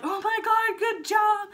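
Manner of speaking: high, bright cheer of joy; excited cheer. Retold or imitated. Family cheering the new mother's big push, excited. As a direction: cheer her on like family at the birth.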